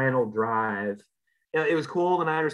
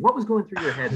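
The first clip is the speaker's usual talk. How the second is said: lightly imitated sideline interviewer, higher pitch; Aaron Andrews; 6 semitones higher than usual. Co-host playing interviewer asks about the game, lightly imitated reporter. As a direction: put the question like a mock TV reporter. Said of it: "I feel like I'm Aaron Andrews asking".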